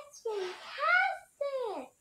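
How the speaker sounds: loud, high, slow cry of delight; overjoyed and very loud. Acted. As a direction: shout out how wonderful it is. Narwhal loves the cookie.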